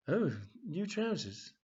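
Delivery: a flirty, lingering once-over in the voice; lightly voiced nosy lady; cheeky customer. [name] eyes assistant's trousers.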